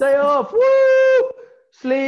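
loud, high-pitched celebration; shouted party whoop, high and gleeful; whooping cheer. Real, not acted. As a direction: cheer wildly for a day off. Co-host cheers the day off.

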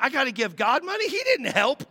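put-on high, fast complaining child; boy objecting to paying God; indignant kid. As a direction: protest like a cheated little boy. Child protesting giving money.

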